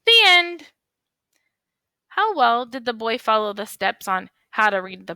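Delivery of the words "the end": high, loud, proud finish; announcing the end. Shown as a demonstration. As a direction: declare it like a grand finale.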